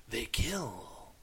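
ominous whisper. Pretend. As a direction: breathe it like a horror trailer. Ominous movie tagline read.

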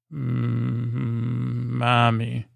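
mock snore talk. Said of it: "this kind of Three Stooges-esque snore".

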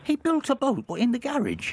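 can't believe his dad built a boat; amazed disbelief. Real, not acted. Host amazed at caller's dad.

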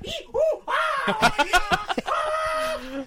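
high, loud mock shrieks; hammed-up screeching performance art; imitated wailing. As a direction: imitate avant-garde wailing, vowel after vowel. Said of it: "A lot of l-, wailing".

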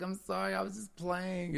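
high, soft retold apology; pleading child. Grandchild pleading with grandmother before a spanking, mock apology. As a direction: imitate a kid begging off in a small high voice.

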